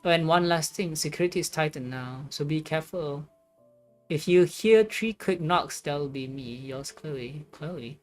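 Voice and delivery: normal talking voice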